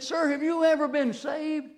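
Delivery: high, slow and pleading; earnest plea. Retold or imitated. Young man earnestly asks the old wino, high and slow. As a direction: lean in and ask it earnestly, a little loud.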